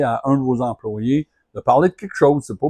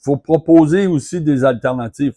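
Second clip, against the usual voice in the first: ordinary talk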